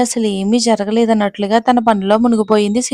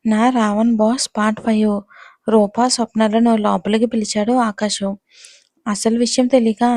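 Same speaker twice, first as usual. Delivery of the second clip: plain reading voice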